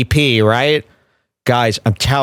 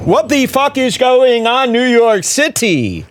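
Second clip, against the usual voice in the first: laughing, higher, hammed-up roast-comic opener; a mock Tony Hinchcliffe working the crowd; Tony Hinchcliffe impression; 10 semitones higher than usual. Streamer mocking a comedian's opener, hammed-up higher voice with a laugh. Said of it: "It's like Tony Hinchcliffe".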